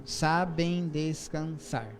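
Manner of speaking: "sabem descansar" stretched slowly syllable by syllable; slow counted reading of the last verse; syllable counting. Shown as a demonstration. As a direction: scan "sabem descansar" beat by beat. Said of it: "E o último".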